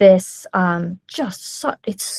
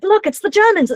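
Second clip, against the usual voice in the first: high, louder alarmed cry; shell-shocked co-pilot; 12 semitones higher, 5 dB louder than usual. Retold or imitated. Co-pilot alarming the pilot about the Germans, high and loud. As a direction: point out the obvious in a panic. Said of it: "co-pilot just keeps being like".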